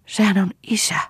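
high-pitched cry of despair; desperate exclamation, pitch jumping up. A narrator's reading. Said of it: "huudahti Lea epätoivoissaan".

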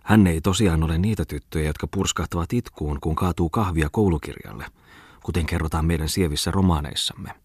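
plain reading voice

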